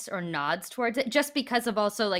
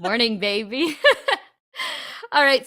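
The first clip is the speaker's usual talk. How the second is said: laughing; 5 semitones higher, 10 dB louder than usual. Real, not acted. Teasing yawning cohost.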